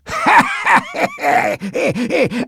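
high-pitched cackling laugh; big acted belly laugh, pitched right up; loud laughter. A narrator's reading. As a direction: burst out in a long, high laugh. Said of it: "Sidney laughed out loud".

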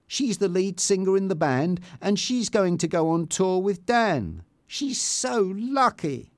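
envious complaint, slowing at the end; understated jealous grumble; angry thought. A narrator's reading. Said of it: "Tanya was angry".

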